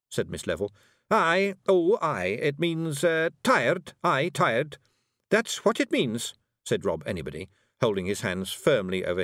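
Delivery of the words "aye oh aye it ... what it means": high Scots Feegle, hurried cover-up; flustered Feegle Rob. A narrator's reading.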